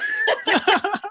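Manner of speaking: high, loud cackle; imitated mocking laugh. Family laughing at the boy, imitated loud cackle. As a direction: crack up in hammed-up ridicule. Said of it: "they're all laughing at me".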